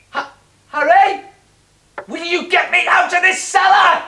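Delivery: slow, high, drawn-out calls from the cellar; slow calling out. Acted. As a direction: plead slowly to be pulled out.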